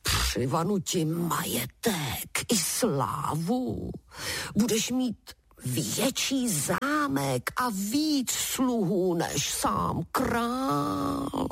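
slow giant voice with long-drawn vowels; promising giant. A narrator's reading. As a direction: promise grandly, stretching out the words. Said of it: "sliboval obr".

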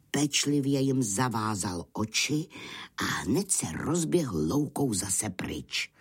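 plain reading voice

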